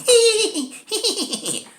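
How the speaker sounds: very high, squealed hee-hee cackle; creepy mock giggle. Witch's strange people closing in on victims, mock creepy giggle. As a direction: imitate little fiends tittering in a high squeal.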